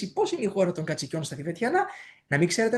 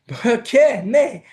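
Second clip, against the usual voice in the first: pokhe with a laugh; 6 semitones higher, 7 dB louder, much slower than usual. Shown as a demonstration. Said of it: "believe it or not".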